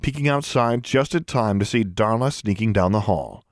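ordinary delivery

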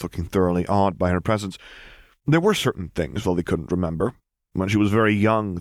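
plain reading voice

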